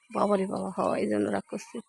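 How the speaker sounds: low, soft muttering to herself; quiet aside. Real, not acted. To herself about the sulking cow, soft mutter. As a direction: mutter the complaint under your breath.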